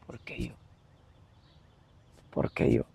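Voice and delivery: barely voiced, hushed and broken; anguished question. Alone in bed after fleeing, asking himself, hushed anguish. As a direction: ask it almost under your breath, lost.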